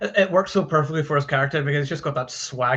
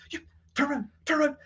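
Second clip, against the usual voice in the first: losing it; 14 semitones higher, 3 dB quieter, faster than usual. Retold or imitated. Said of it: "totally loses it".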